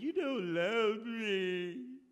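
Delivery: hammed-up weepy complaint; tearful accusation. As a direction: drag it out like a wife in tears. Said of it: "came to him in tears and said".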